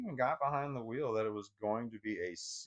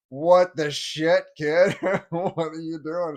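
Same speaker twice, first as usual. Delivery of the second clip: loud, slow, half-laughing "what the shit"; laughing yell; 5 semitones higher, 11 dB louder, slower than usual. Retold or imitated. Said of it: "And I'm like".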